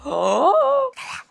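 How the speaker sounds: a gasp, then slow, high look at that; gasping in delight. Real, not acted. Showing viewers a sweet manga panel, breathless delight. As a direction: catch your breath at the picture.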